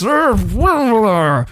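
drawn-out raging "urgh", starting high and falling; hammed-up, rolling roar of frustration; bigger angry growl. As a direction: growl in waves as anger flares up. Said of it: "deeper flare up of his anger".

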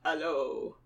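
low, soft villain greeting; the Count. Acted. Count swivelling round in the armchair, low slow greeting. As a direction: greet them deep and quiet as the Count. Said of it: "And he says".

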